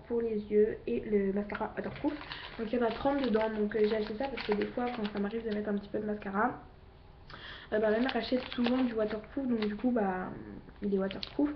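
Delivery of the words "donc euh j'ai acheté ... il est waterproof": usual speaking voice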